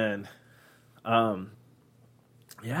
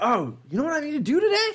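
chirpy high voice; 13 semitones higher, faster than usual. Retold or imitated. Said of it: "And I'm like".

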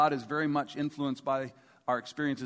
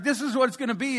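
higher-pitched excited mother; 7 semitones higher, 4 dB louder, faster than usual. Retold or imitated. Mother showing the ring to her sons, excited. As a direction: lift the pitch, eager mom announcing her gift.

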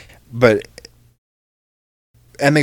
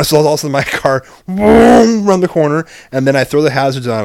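sudden loud burst, pitched up high on boom; revving up the story, louder and higher; excited retelling; 5 semitones higher, 7 dB louder than usual. Retold or imitated. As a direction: punch out the boom like a car lurching. Retelling flooring the car.

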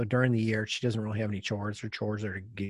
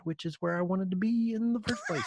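higher, satisfied punchline; Cosby voice; 8 semitones higher than usual. Retold or imitated. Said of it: "he goes".

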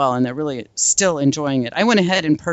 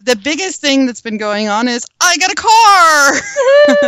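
bursting with joy, shouting the news; excited shout; 13 semitones higher, 8 dB louder, slower than usual. Real, not acted. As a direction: yell it out, thrilled. Excited car news announcement.

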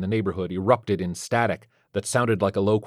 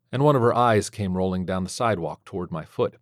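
plain reading voice

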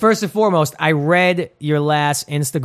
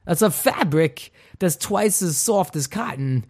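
slow impression with halting, oddly placed emphasis; Christopher Walken; 3 semitones higher, slower than usual. Host hamming up an underwear ad line, slow Walken impression. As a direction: stretch it out like the actor's famous cadence. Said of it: "I just went Christopher Walken".

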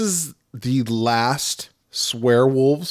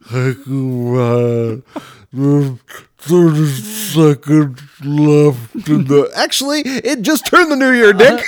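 put-on very slow, halting old man; old Dick Clark; 4 dB louder, slower than usual. Host imitating frail Dick Clark counting down, very slow halting. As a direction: drag every word out like frail Dick Clark. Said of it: "took you five minutes to say that".

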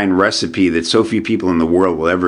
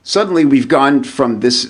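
ordinary delivery

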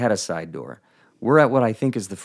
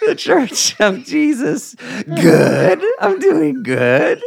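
higher, louder, laughing, slowed blurt; laughing flustered shout; 8 dB louder, slower than usual. Retold or imitated. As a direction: replay the embarrassing mix-up, cracking up. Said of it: "That's how I said it".

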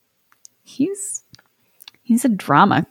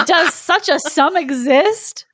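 slow, higher-pitched disbelief; astonished wizard; 6 semitones higher, much slower than usual. A narrator's reading. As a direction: stretch it out in storybook amazement. Astonished wizard in read story.

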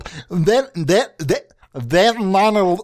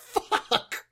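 breathless whispered fuck; real whispered swear; whispered curse; 7 semitones higher, 9 dB quieter than usual. Real, not acted. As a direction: curse under your breath, defeated. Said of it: "freaking the fuck out".

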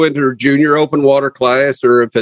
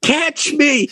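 mock call; 11 semitones higher than usual. Joking along with the jump yell.

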